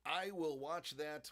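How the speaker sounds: slow, drawn-out promise; slow and deliberate. Shown as a demonstration. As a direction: stretch each word out slowly. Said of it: "I will watch that as soon as the show is over".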